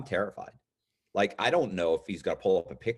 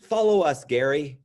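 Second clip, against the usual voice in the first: higher and louder command; cop's order; 4 semitones higher, 6 dB louder than usual. Retold or imitated. Deputy telling Gary to follow, loud mock order. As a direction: bark it out like a cop. Cop ordering him along.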